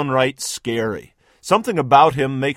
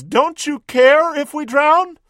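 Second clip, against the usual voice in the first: slow, much higher whine; whining; 13 semitones higher, 4 dB louder, slower than usual. Acted. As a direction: whine it like a frightened child. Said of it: "Another whines".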